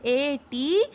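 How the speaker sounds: slow, letter-by-letter spelling of M E A T. Shown as a demonstration. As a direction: spell M E A T out slowly, one letter at a time. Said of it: "यहाँ पे मीट की spelling क्या है".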